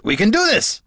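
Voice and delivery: high, eager dwarf shouting; excited shout. A narrator's reading. Gorm shouting to the bard, high and eager. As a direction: shout it like the plan is already won. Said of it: "Gorm shouted".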